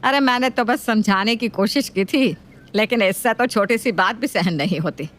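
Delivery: nagging mother-in-law voice. Acted.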